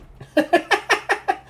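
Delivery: a shrill burst of laughter; high squealing laugh. Real, not acted. GM laughing at reroll.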